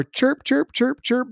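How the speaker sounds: mock chirping. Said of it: "mocking the guys' chatter".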